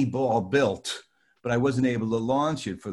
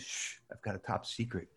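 hushed secret; 3 semitones lower, 12 dB quieter than usual. Retold or imitated. Confiding secret to fan.

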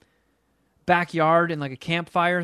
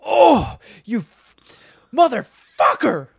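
"Oh" yelled high and loud, then a slow, loud "motherfucker"; retold furious yell; furious outburst; 6 semitones higher, 7 dB louder, slower than usual. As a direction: explode at the TV in rage. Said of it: "I was pissed".

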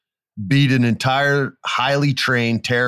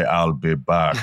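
Schwarzenegger line; 5 semitones lower, slower than usual. Retold or imitated. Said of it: "seeing Arnold Schwarzenegger say".

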